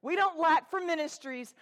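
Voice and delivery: ordinary talk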